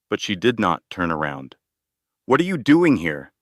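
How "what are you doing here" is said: higher, louder burst of a question; shocked outburst, pitched up and loud; louder than intended. A narrator's reading. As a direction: blurts it out too loud. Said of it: "Ethan's voice was louder than he intended".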